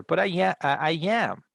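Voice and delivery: "am" pitched high for emphasis; stress on AM. Shown as a demonstration. Teacher answers the dancer example to show emphasis, stress on "am". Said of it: "we emphasize in the verb to be".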